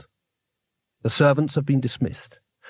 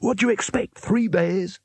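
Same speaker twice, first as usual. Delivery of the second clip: higher-pitched, scornful leader; snarling sarcasm; 6 semitones higher than usual. A narrator's reading. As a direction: throw the question back in exasperation. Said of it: "snarled the leader".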